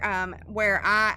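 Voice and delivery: ordinary talk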